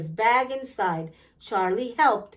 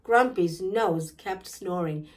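ordinary delivery

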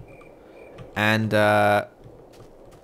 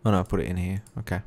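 normal talking voice

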